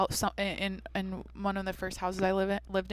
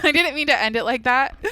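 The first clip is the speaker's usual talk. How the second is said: real laugh, higher and louder; amused, embarrassed laugh through the words; 6 semitones higher, 11 dB louder than usual. Real, not acted. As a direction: laugh at yourself for sounding cheesy. Laughing at her cheesy sign-off.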